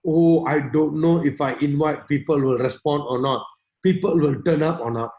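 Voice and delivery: low, worried inner monologue; negative self-talk. Acted. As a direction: mimic a doubter's low-pitched misgivings. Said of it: "mindset always keep telling them that".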